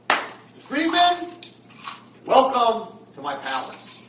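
high, booming, drawn-out greeting; loud grand welcome. Acted. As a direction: call it out slowly and grandly. Roommate grandly greets the new arrival.

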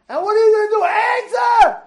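high, loud imitation of hecklers; demanding shout. Protesters pressing the mayor to answer, high demanding shout. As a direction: yell for an answer, hard on the last word.

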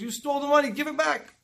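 high, insistent acted accusation; retold stern demand. Rebbe confronts the partner over stolen money, insistent retold demand.

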